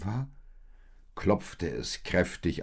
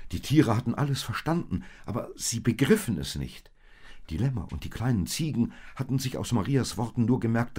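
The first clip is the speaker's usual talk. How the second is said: excited narration; 6 semitones higher, 5 dB louder, faster than usual. A narrator's reading. Narrator builds alarm.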